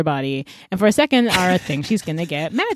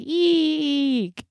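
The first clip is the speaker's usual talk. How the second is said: mock frightened squeak; 10 semitones higher than usual. Boys scared off by girls.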